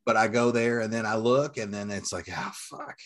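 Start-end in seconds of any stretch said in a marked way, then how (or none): 2.3-3.0 breathy whispered oh fuck, voice gone high; a disappointed whisper at the empty shelf; whispered curse. Retold or imitated. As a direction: let it slip out as a deflated whisper.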